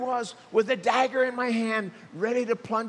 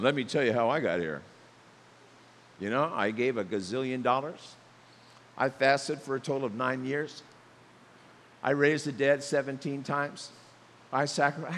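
lower, fast, lightly mocking braggart; a hint of a bragging saint, lower and quicker; smug boaster; 7 semitones lower than usual. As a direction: rattle off your brags smugly. Boastful person bragging in heaven.

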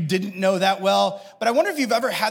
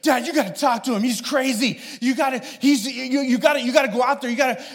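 urgent, higher-pitched plea to dad; worked-up kid begging dad to step in; out-of-breath son; 6 semitones higher than usual. Retold or imitated. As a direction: imitate a frantic kid tattling in a rush. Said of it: "he's, like, out of breath".

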